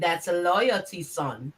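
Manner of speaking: imitating the deep quote. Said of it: "How you said it?".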